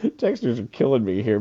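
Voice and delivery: normal talking voice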